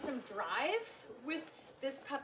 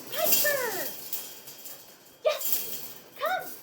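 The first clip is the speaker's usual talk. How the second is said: calling the dog; 10 semitones higher, 8 dB louder, much slower than usual. Real, not acted. Trainer calls dog.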